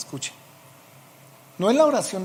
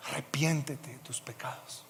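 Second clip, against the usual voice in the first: susurro; 2 semitones lower, 10 dB quieter than usual. Retold or imitated. Padre moribundo, hijo le predica susurrando.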